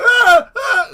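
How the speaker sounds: two loud, very high screams; high mock screaming; imitated screams. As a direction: shriek like the thing screaming in the backyard. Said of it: "you hear these screams".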